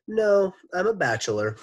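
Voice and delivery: a proper teacher answering politely; Mister Gorf voice. Acted.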